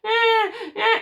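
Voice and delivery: put-on high-pitched baby whimpering cry; mock baby crying, not yet angry; milder baby cry. Shown as a demonstration. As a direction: imitate a baby's fussing wail, high. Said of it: "the voice is very different. So instead of this".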